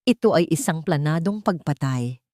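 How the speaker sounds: plain reading voice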